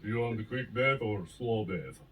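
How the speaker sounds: put-on accidental Russian accent. Host as local offering deaths, fake Russian accent. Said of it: "That's Russian".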